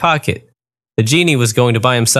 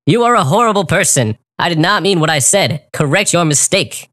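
higher, lightly raised angry wolf; mild mock yell; 5 semitones higher than usual. A narrator's reading. Wolf scolding the genie, a mild mock yell. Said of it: "I yelled".

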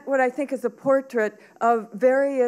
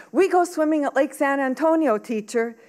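higher-pitched pupil blurting eagerly; blurted out; 6 semitones higher, 3 dB louder than usual. A narrator's reading. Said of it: "blurted out".

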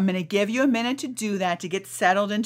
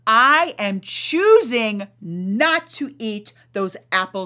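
slow, loud, each word weighted; firm affirmation; 3 dB louder than usual. Shown as a demonstration. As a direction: declare it with calm resolve. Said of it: "this is what you're gonna say".

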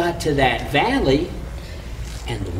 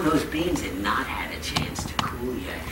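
whisper; 5 semitones lower, 9 dB quieter than usual. A narrator's reading. Storyteller drops to a whisper.

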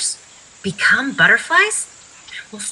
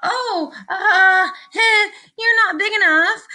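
high, stammering excuse; nervous backing down; 9 semitones higher, 3 dB louder, slower than usual. A narrator's reading. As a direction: back down with a nervous little high voice. Grouchy ladybug backing down from mantis.

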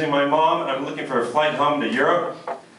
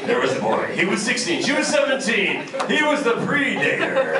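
movie trailer voice; 8 semitones higher than usual. Acted. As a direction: announce it like a movie trailer narrator.

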